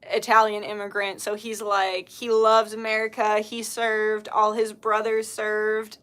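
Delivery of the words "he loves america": usual speaking voice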